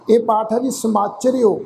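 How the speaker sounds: ordinary delivery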